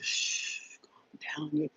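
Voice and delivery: soothing a noisy mind under your breath; hushing whisper. Pretend. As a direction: whisper it, shushing the thoughts. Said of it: "Basically telling the thoughts to".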